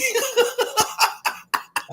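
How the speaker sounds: sudden high cackling laugh; real laugh at his own joke; high burst of laughter. Real, not acted. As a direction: break into a high helpless laugh. Cracking up at own story.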